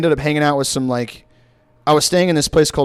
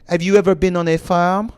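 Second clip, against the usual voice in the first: mock French student's English, higher; French accent; 4 semitones higher than usual. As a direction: ask it as a baffled French student. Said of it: "they started speaking French".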